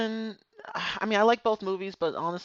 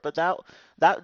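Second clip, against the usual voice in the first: normal talking voice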